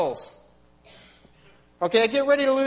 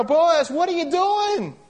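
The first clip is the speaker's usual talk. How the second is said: exasperated outcry; 9 semitones higher, 5 dB louder, slower than usual. Pretend. Preacher voicing the worried onlooker.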